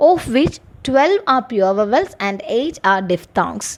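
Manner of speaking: slow, measured reading of an exam question for students; slow dictation reading. Acted. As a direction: read it out slowly, word by word, like dictating to a class. Reads exam question and answer.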